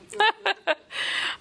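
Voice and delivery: high-pitched burst of laughter. Real, not acted. Preacher laughing at a fond memory of grandmother's cooking.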